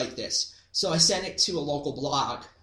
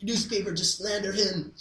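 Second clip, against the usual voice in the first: exchange student voice; 5 semitones higher, 3 dB louder than usual. Retold or imitated. Exchange student praising the uncle.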